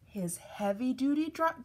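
plain reading voice

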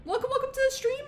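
high, loud, bubbly thank-you shout-out; bright high-pitched streamer welcome voice; excited streamer greeting. Acted. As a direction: hype up the new follower. Streamer thanks new follower.

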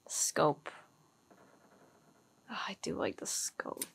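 soft breathy whisper to herself. Real, not acted. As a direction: whisper it under your breath. Quiet aside while upgrading weapon.

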